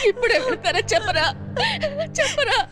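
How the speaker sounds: high, urgent plea through sobs; tearful pleading. Acted. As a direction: beg the boy, crying and frightened. Pleading while boy is beaten. (ఏడుస్తూ బతిమాలడం)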